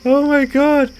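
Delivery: high, loud laughing relief; relieved laughter. Real, not acted. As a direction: laugh out the shock. Laughing off a jump scare.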